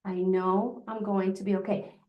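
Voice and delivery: soft, comforting shush to yourself; calm yourself down like a child; soothing self-talk. Shown as a demonstration. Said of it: "just go down the arms and just say".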